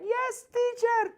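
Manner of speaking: put-on squeaky high childlike yes teacher; high mock child voice. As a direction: mimic a small child answering sweetly. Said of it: "and you are like".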